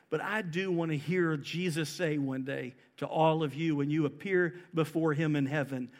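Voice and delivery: usual speaking voice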